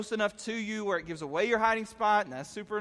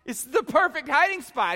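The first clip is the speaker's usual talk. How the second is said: mock shout at brother; 6 semitones higher, 7 dB louder than usual. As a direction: defend your spot loudly. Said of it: "I'd be like".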